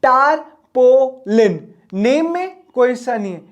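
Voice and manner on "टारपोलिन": टारपोलिन slowly as "tar", "po", "lin"; three spaced-out beats on टारपोलिन; split into three syllables. Shown as a demonstration. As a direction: pause after each syllable of टारपोलिन. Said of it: "तीन छोटे छोटे हिस्से हैं".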